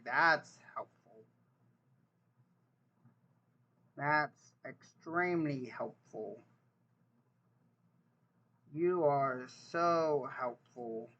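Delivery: slow, dry sarcastic thanks; drawn-out deadpan sarcasm. Real, not acted. Mocking a useless search result, slow and sarcastic.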